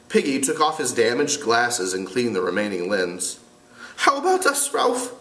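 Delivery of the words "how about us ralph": put-on much higher, nervous fat boy; timid boy voice. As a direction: plead timidly as Piggy. Piggy voiced.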